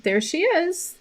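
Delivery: high, sing-song imitation of staff presenting the puppy; chirpy, proud announcement; cheerful shelter staff. Shelter worker proudly hands over the puppy, high and sing-song. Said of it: "they were like".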